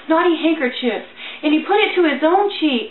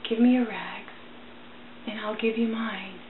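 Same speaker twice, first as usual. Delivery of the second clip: tender whisper; 4 semitones lower, 9 dB quieter than usual. A narrator's reading. Ragman comforts the wounded child.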